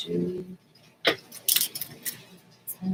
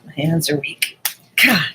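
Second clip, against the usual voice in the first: straining at a stuck lid; opening a stuck jar; 7 dB louder than usual. Real, not acted. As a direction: push the words out while twisting hard. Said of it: "It's so hard you can't get the lid off".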